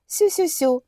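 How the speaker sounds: breathy cutesy giggly; high cooing baby talk. Acted. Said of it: "соловьиную трель".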